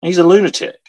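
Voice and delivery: high, halting, incredulous inner voice; stunned disbelief. Retold or imitated. Voices the shocked major's thoughts.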